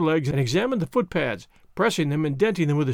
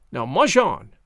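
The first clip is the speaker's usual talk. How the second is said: firm order to a dog; sharp command; 3 dB louder than usual. A narrator's reading. Man driving his sled dog ahead, sharp command. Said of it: "His voice rang out sharply".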